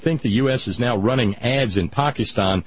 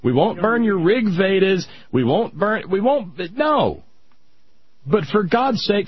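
raised pitch, dragging plea to stop; exasperated scolding; 8 semitones higher than usual. Pretend. Speaker to religious people, exasperated high-pitched scolding. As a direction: scold them like a fed-up neighbour. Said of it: "that we could say".

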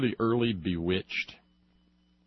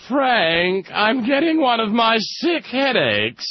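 fussy mother-in-law; 11 semitones higher, 7 dB louder, slower than usual. Retold or imitated. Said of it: "she would look up and say".

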